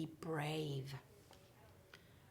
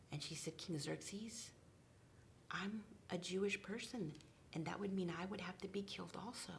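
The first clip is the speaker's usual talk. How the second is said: soft whisper, mostly unvoiced; whispering the tense moment of the story; hushed telling; 8 dB quieter, faster than usual. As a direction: keep it hushed and secret. Hushed narration and Esther's plea.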